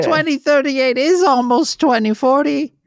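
a silly character complaining about sewage; high comic neighbour voice. Pretend.

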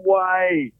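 high-pitched cry of disbelief; stunned no way, voice jumping way up; shocked disbelief. Retold or imitated. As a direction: squeal it out in shock. Shocked at mole reveal.

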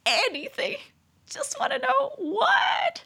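very high, slow, shouted plea; eager squeal. Real, not acted. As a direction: beg to know, squealing. Nosy shouted question.

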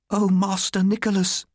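very high sustained wail; grieving cry. A narrator's reading. Martha laments to young Nicholas, crying out high. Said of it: "she cried".

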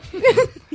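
shrieking burst of laughter; high squealing laugh. Real, not acted. Laughing at Teneriffa.

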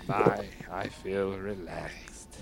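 very slow, low, relaxed sigh; blissed out. Acted. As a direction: melt into deep relaxation. Blissed out after a drink.

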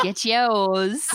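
mock sales cry; playful call-out. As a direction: call it out high and loud for a laugh. Joking shout like a salesman.

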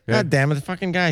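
ordinary talk

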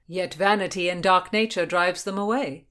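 projected, insistent retort; insisting. A narrator's reading. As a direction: press the point. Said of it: "William insisted".

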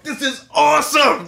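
thrilled. Retold or imitated. Watching kendo fighters, shouting with excited delight. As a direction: gush at the spectacle. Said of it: "and I'm like, look at everybody".

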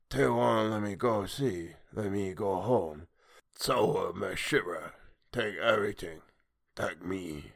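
slow, fading broken plea of a sea creature. Acted. Creature pleads to go home.

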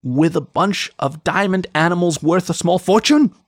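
incredulous outrage climbing in pitch; building towards yelling. A narrator's reading. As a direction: start strained, end in a high-pitched yell. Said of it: "he was yelling by the end".

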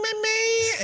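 very high piercing Mommy; shrill child cry. Retold or imitated. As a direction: wail it like a kid. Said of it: "voice that I think can cut through stainless steel".

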